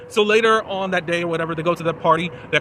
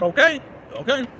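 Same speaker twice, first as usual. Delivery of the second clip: high, rising voice; lightly acted, questioning okay; mock tentative agreement; 7 semitones higher than usual. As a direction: imitate someone going along, pitch lifting upward. Retells Ty agreeing to the proposal.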